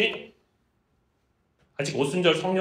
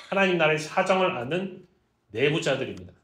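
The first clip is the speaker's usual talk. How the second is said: normal talking voice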